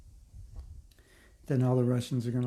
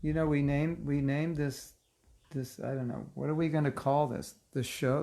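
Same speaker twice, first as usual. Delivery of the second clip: ordinary talk, nothing marked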